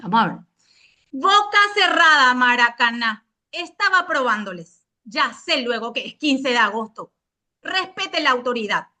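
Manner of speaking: loud, curt order for silence; authoritarian teacher. Pretend. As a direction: bark it at the class. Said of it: "léase con tono cortante y autoritario".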